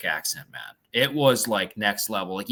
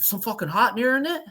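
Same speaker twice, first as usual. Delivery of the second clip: put-on Newfoundland accent; 10 semitones higher than usual. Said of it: "he's like".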